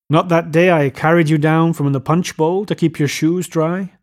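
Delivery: plain reading voice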